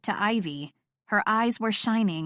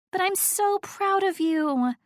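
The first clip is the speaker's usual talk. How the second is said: high, slow, drawn-out gush; gushing pride; 10 semitones higher, slower than usual. A narrator's reading. Friend praises Jen, gushingly proud. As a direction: stretch it out high, bursting with pride.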